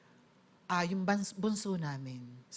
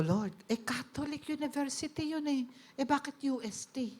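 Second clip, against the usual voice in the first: puzzled question; 7 semitones higher than usual. Retold or imitated. Questioning Lord about the school.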